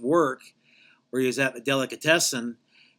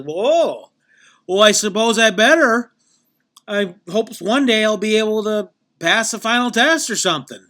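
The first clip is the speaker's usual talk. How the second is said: mock reluctant agreement; 7 semitones higher, 8 dB louder than usual. Daryl reluctantly agrees to join.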